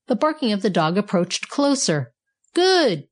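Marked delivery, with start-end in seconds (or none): 2.5-3.1 high-pitched burst of approval; storybook exclamation of glee; gleeful cry. A narrator's reading. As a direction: pop it out high, delighted. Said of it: "cried Righty".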